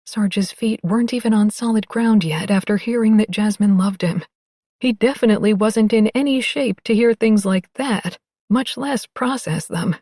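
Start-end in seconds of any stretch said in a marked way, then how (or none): none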